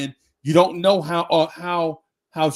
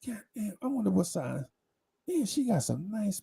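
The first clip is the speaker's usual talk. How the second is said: much softer, higher, leering; hushed inner thoughts; 5 semitones higher, 8 dB quieter than usual. Retold or imitated. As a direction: let it slip out like a private thought. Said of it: "Instead of thinking like".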